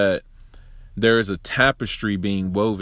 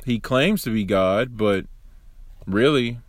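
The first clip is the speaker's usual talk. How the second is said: ordinary delivery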